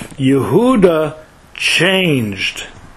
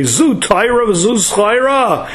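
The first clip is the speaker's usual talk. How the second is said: higher, mildly impassioned retold outcry; retold plea, lightly anguished; crying out in protest; 7 semitones higher than usual. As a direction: voice the angels' protest in a raised pitch. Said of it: "the angels cried to Hashem and say".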